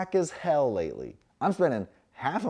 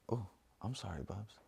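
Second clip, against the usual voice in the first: tender apology; 3 semitones lower, 11 dB quieter than usual. Real, not acted. Apologizing to dog.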